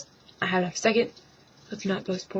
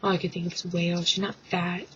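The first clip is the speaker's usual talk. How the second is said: normal talking voice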